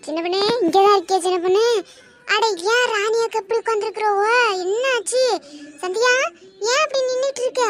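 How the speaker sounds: loud, high, quick calling into the house. Acted. Old aunt arrives calling loudly for the young woman. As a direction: shout ahead to find who is home.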